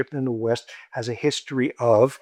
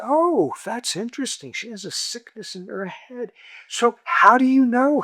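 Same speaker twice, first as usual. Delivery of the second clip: mock fascination; 10 semitones higher than usual. Teller plays naive interviewer.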